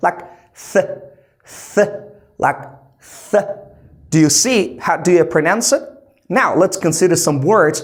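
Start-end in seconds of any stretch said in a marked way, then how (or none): 0.7-1.9 "th" as a little voiceless puff; tongue tip between the teeth, puff out "th"; unvoiced TH puff. Shown as a demonstration. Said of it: "pronounce it with a little puff". 3.3-3.5 "th" as a little voiceless puff; tongue tip between the teeth, puff out "th"; unvoiced TH puff. Shown as a demonstration. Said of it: "pronounce it with a little puff".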